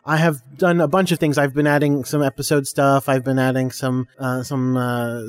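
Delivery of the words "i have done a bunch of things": ordinary talk, nothing marked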